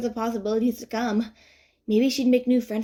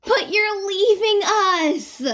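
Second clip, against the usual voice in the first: high, slow, wailing mock despair; dramatic swoon; 11 semitones higher, 6 dB louder, much slower than usual. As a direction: ham up the heartbreak like a soap opera. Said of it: "Mizuki swoons dramatically".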